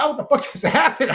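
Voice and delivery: loud, high, half-laughing bewilderment; retold shock. Kid just hit by a bat, retold high startled cry. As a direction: act out startled disbelief with a laugh in it. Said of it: "I was like".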